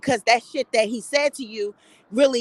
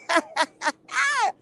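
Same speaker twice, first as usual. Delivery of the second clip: real high-pitched amused cackle; high laugh; 7 semitones higher than usual. Real, not acted. Retelling Santana's crude threat, amused high laughter. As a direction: crack up at your own retelling.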